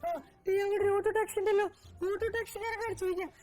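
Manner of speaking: high, light lady's voice; high woman customer voice. Acted. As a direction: play a chatty woman passenger. New customer character arrives.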